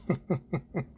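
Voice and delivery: short, quiet run of chuckles; soft, amused laugh. Real, not acted. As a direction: chuckle to yourself before answering. Laughs before answering the question.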